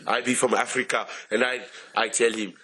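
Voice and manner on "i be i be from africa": put-on African accent. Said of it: "I will do my African voice".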